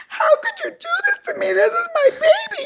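high, wailing sobs between shouted words; hysterical crying. Retold or imitated. Young employee crying and shouting at her billionaire boss. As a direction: reenact the breakdown, crying and yelling at once. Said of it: "I'm hysterical crying".

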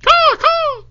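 two high cawing cries; very high mock bird call. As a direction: caw twice like a crow.

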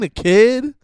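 ordinary talk

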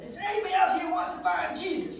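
high, loud call to the crowd; imitated tent preacher. Preacher asking the congregation for converts, calling out. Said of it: "the preacher says".

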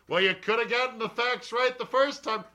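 high put-on heckler voice; mock his own critic in a high voice.

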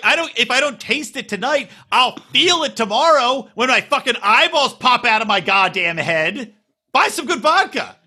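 high-pitched comic rant at a parent; exasperated comeback. Retold or imitated. Retold exasperated argument with father.

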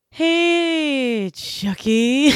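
long drawn-out high hey; elongated greeting. Pretend. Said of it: "Elongating, uh, nouns and, and, and sounds".

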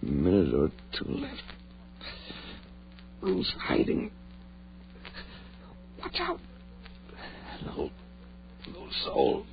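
faint, broken words; dying gasps. Acted. Dying Ed gasps to David, faint broken words. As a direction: fade out with each breath.

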